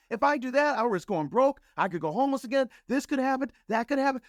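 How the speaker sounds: high, rising panic, voice climbing; frantic pleading as if facing ruin; panicked protest. Retold or imitated. To his coach, a panicked high-pitched refusal.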